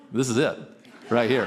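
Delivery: chuckling through the punchline; laughing. Retold or imitated. Retelling his reply to a coworker, laughing. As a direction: laugh while you admit it.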